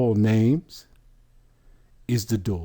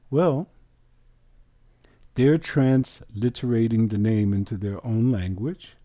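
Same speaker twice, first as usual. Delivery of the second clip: normal talking voice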